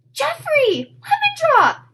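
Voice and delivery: very high, loud delighted squeal; boy squealing names in delight. Acted. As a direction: squeal with joy at the lost mice. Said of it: "Jared squealed".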